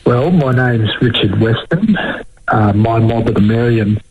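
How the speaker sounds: usual speaking voice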